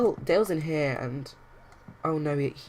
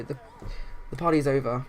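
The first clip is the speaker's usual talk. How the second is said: ordinary delivery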